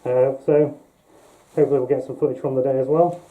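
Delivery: talking through a full-face mask; muffled through mask. Real, not acted. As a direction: voice muffled behind a latex mask. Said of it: "my voice is gonna be muffled through the mask".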